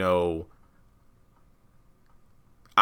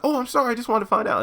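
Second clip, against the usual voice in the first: much higher, fast squeaky apology; sheepish; 4 dB louder, faster than usual. Retold or imitated. Tally apologizing to angry Ruth, high and flustered. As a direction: gush a flustered sorry.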